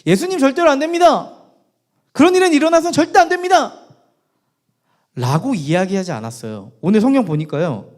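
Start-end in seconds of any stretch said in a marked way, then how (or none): 0.0-3.7 loud, high, forceful objection; acted urgent outcry; Peter's vehement protest. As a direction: forbid it outright, voice raised. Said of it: "베드로가 얘기하는 거죠".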